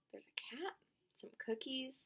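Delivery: whisper. Real, not acted. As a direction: whisper it like a little secret. Previewing pictures for children.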